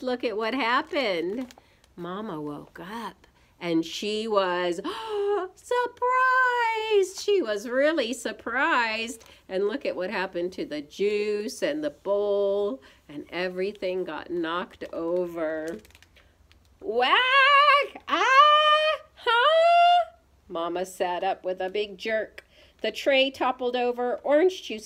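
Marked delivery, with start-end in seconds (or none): 4.8-7.4 gasp, then a high, loud word; exaggerated high-pitched astonishment; startled. A narrator's reading. Acting out Mama's startled waking. 16.8-20.1 very high, loud cries of shock; shrieky storybook crash and gasp; startled yelps. A narrator's reading. Crash as Mama jerks awake.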